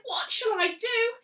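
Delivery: high, loud cry of dismay; old lady crying out in despair; cried out. A narrator's reading. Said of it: "The little old lady cried".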